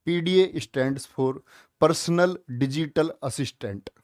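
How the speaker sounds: drawn out for the key term; slow the phrase so it sticks; slow dictation. Shown as a demonstration. Teacher stressing an important question, said slowly. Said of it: "important question है".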